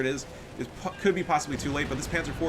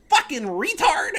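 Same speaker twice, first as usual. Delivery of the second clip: high, slow, loud mock of the rival; mocking quote; 7 dB louder, slower than usual. Quoting the salty opponent's chat, high mocking voice. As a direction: ham up the opponent's insult. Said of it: "He goes".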